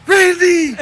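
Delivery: high shrieking yell of a name; mock scream. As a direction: shriek the name in fake fright. Mock terror at screaming singer.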